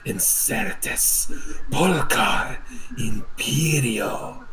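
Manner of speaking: very slow, intoned prayer; solemn incantation. Acted. Cleric heals the fallen friend with a slow incantation. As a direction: chant the words like a rite. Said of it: "as he says".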